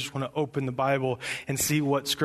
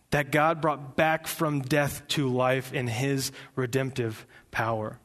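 normal talking voice

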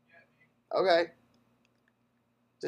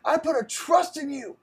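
high, loud, hammed-up wailing plea; mock heartbroken drunk; 10 semitones higher, 4 dB louder than usual. Friend mocking his buddy's drunk texts, high and loud hammed-up wailing. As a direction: wail it like a betrayed brother.

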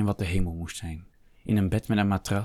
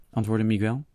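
usual speaking voice

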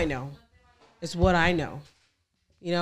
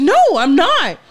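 firm retort; 8 semitones higher, 8 dB louder than usual. Retold or imitated. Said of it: "And I said".

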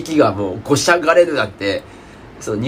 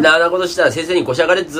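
quick, higher Tohoku dialect warning; 4 semitones higher, faster than usual. Shown as a demonstration. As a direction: warn someone in brisk Tohoku dialect. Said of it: "そんなことしたら先生にごしゃがれるぞっていう". (早口の東北弁で)

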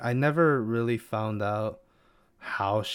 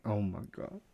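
hushed, a lot softer; softly overwhelmed oh my god; 8 dB quieter than usual. Retold or imitated. Fan reacting to the wife question, hushed and moved. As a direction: say it under your breath, moved. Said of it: "I'm just like".

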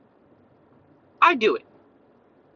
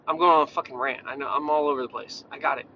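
ordinary delivery

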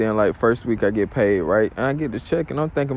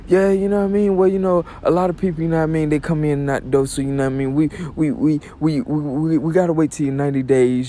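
higher, quick excuse-making voice; stammering boss; 5 semitones higher than usual. Retold or imitated. Boss putting off worker's pay question, mock stammering excuses. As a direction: imitate an evasive boss, stuck repeating words.

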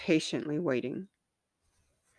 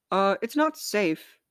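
anxious repeat; 6 semitones higher than usual. A narrator's reading. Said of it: "mind repeated".

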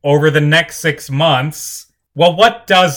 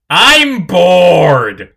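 bored whine; 5 semitones higher, 6 dB louder than usual. Acted. Duke whines on the broadcast.

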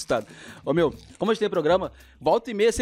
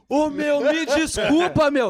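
slow, higher, embarrassed sorry; hammed-up sheepish apology from the customer; flustered apology; 5 semitones higher, 3 dB louder, much slower than usual. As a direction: stretch out the apology, caught out.

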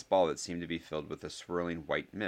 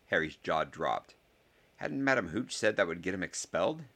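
usual speaking voice

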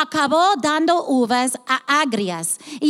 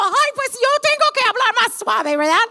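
mock sheepish; 9 semitones higher than usual. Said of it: "Y yo digo".

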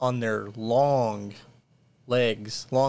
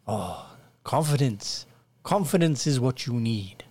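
peasant villager. Acted. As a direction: welcome the lords like a fawning villager. GM voices peasant Abel.

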